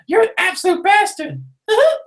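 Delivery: Mickey Mouse. Pretend. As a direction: squeak it like an evil cartoon mouse boss. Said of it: "That's my Mickey Mouse impression".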